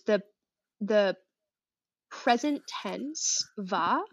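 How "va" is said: ordinary talk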